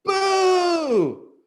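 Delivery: high loud boo. Acted. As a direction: shout it to scare. Lance scares the lady.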